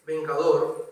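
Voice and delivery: ordinary talk, nothing marked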